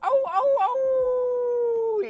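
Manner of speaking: high, loud, tearful oh's that break; moved to tears. Real, not acted. Touched by a viewer's kind comment, tearful high cries. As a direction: cry out, really touched. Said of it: "You're making me, making me cry".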